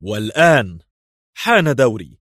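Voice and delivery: loud, triumphant warning; menacing shout. A narrator's reading. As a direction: call it out as a threat. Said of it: "وصاح".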